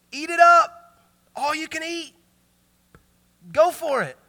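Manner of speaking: God's voice. Acted. Preacher voices God inviting Adam to feast, booming. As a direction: play God offering the whole garden. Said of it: "God says".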